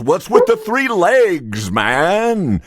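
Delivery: mildly exasperated salesman; puzzled salesman. Acted. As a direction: press the farmer, stretching the words. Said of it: "The guy goes".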